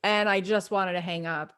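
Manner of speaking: ordinary delivery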